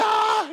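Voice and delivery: high loud mock yelp of laughter; sudden high laughing "Ah!"; burst of laughing cry. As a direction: shriek out a cracked-up laugh. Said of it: "be like".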